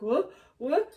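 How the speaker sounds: short, questioning mock-clueless voice; playing dumb. [name] asked if he was laughing, playing dumb. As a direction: act innocent, as if you missed it. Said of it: "acting stupid".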